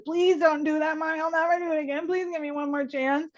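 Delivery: put-on high, begging kid voice; pleading child. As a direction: plead like a child dodging timeout. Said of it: "but he says".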